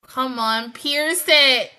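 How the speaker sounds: loud, impatient cheer at the screen; urging on. Real, not acted. Viewer to the character fighting on screen, urging loudly.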